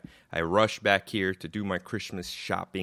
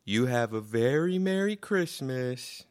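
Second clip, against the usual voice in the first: high, drawn-out cashier greeting; cheery cashier impression; 6 semitones higher, 4 dB louder, slower than usual. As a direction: gush a slow, sing-song holiday wish. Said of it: "and she goes".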